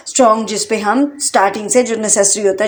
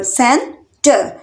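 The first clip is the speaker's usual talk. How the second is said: Centre in two separate beats; split into syllables; much slower than usual. Shown as a demonstration. Teacher counting out two syllables for learners, syllable by syllable. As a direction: pause between सेन and टर. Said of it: "centre में भी टू syllable".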